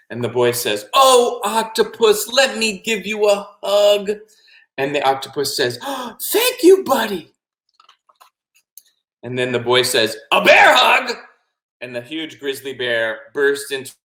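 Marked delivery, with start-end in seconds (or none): 0.9-4.1 high, slow, affectionate reassurance; forgiving little boy. Acted. Boy offers sorry octopus a hug, affectionate. 5.8-7.3 high, slow grateful gush; touched octopus. Acted. Octopus offered a hug, touched and grateful. 10.3-11.2 high, loud gleeful cry; triumphant announcement. Acted. Boy springs bear on octopus, triumphant.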